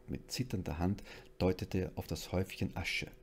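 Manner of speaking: plain reading voice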